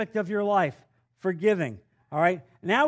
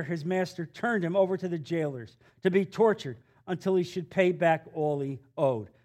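ordinary talk, nothing marked

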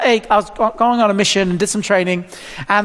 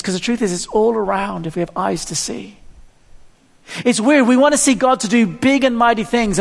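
normal talking voice

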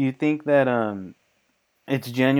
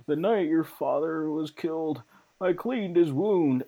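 Bernard the butler; 5 semitones higher than usual. Pretend. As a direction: confide it gravely like an old servant. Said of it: "such a good Bernard frickin' impersonation".

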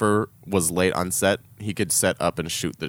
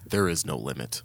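low, soft swaggering declaration; a hint of Michael Bay bravado; deep boastful Michael Bay; 7 semitones lower, 7 dB quieter than usual. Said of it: "Michael Bay says".

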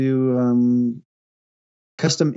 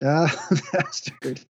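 laughing; 3 dB quieter, much slower than usual. Real, not acted. Laughing over the pun.

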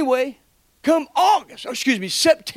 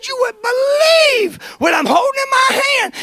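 high, loud shout, crying with joy; tearful joyful shout; 14 semitones higher, 4 dB louder than usual. Retold or imitated. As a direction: cry it out, overwhelmed by joy. Retelling his own overjoyed call.

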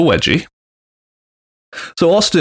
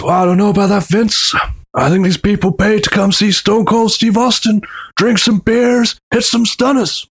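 cocky Austin; 9 semitones higher than usual. Retold or imitated. Austin to Vince, cocky higher mock voice. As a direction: sneer it like a smug star brushing off the boss. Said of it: "Austin, with Paul stood right next to him, goes".

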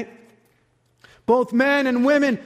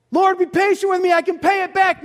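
pleading sinner; 6 semitones higher than usual. Acted. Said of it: "another debt".

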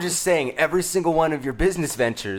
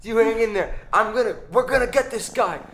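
pleading comfort; 6 semitones higher than usual. Acted. Cop comforts dying cat.

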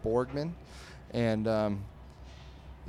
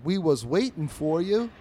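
loud, higher cartoon alley cat; 7 semitones higher, 9 dB louder than usual. Alley cats answering Mooch on the fence, loud cartoon cat voice. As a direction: voice a street-cat gang member, loud and raised. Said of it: "the alley cats go".

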